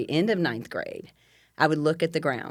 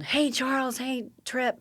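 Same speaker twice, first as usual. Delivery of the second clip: mock yell; 10 semitones higher, slower than usual. Daughter hailing two friends riding by on motorbikes, mock yell. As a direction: imitate a yell to friends at talking volume. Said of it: "I yelled".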